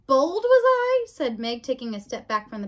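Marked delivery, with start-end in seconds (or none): 0.1-1.1 high, loud, drawn-out question; slow, indignant loud query; affronted mishearing. A narrator's reading. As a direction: blurt it back, taken aback. Mishearing, taken aback.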